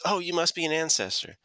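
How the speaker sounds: lightly voiced Picard; Picard impression. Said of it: "and Picard says".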